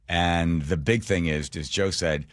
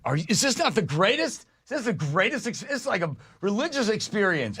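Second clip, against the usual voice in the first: much higher, gushing voice; giddy raving; 12 semitones higher than usual. Retold or imitated. Fan gushing to a stranger at a concert, higher and giddy. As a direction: gush like you're high at a concert.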